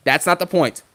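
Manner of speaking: ordinary talk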